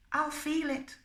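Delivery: high, soft-edged pop star impression. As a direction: do your best imitation of the singer's high voice. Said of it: "That's my best, um, [name] accent".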